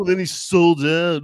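loud, slow mock complaint; mocking imitation. Co-host mocking the guy who says he sold out, slow mock voice. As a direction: drag it out to mock the purist.

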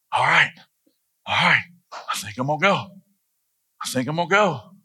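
imitated cocky resolve, drawn between pauses; swagger. Moses deciding to go, trusting his daddy, with swagger. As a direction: play Moses gaining confidence bit by bit. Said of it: "He starts getting his swagger".

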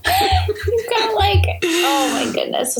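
high, gleeful teasing; delighted teasing. Real, not acted. Co-host teasing her friend about blushing, high and gleeful. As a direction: squeal it out at your friend.

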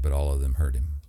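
ordinary delivery, nothing marked

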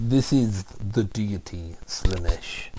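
slow, low announcer intro; slow grave voice-over; dramatic reading. Acted. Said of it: "your dramatic reading".

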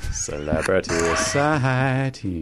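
low, slow words broken by gulps of air; slow, low mock sleep-apnea breathing. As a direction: drag it out low with a weird gulp of air. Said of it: "it's like waking sleep apnea".

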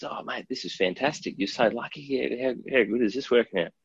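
lightly acted neighbour cheering the luck; excited neighbour. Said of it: "the next door neighbour comes out and goes".

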